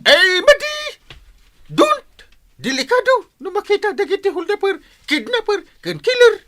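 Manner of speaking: a scared official pleading don't; alarmed warning. Acted. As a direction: warn her off in a panic. Captain warning about robbers.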